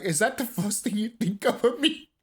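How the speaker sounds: high, hammed-up indignation; mock outrage. Vlogger answering people calling him angry, mock outrage. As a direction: play offended, pitch rising. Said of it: "I'm like".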